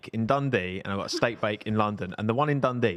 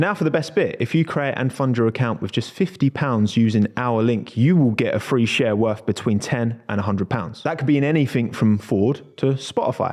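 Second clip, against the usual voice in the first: upbeat sponsor read, a bit louder; 7 dB louder than usual. Acted. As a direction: sell it like a podcast ad. Reads sponsor ad.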